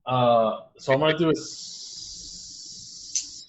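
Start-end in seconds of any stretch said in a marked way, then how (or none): 1.3-3.4 snake voice. Pretend. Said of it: "Second level snake impression".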